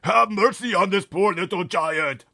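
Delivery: very loud, high terrified giant scream. Acted. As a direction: scream in nightmare terror. Giant keeps begging.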